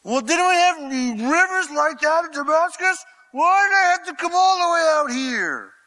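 high, loud, furious ranting; enraged shout. Retold or imitated. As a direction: rage at the insult. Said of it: "And he went away in a rage".

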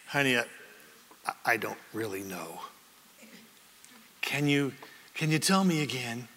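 low, soft, halting admission; humble husband. Acted. Husband admitting to his wife he doesn't know, humble.

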